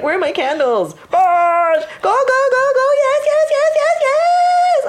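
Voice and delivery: loud, very high frantic yelling; screaming midwife. Retold or imitated. Midwife screaming at the labouring mother, frantic and high. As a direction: whip her up in a shrill frenzy. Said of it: "she'd be screaming at me".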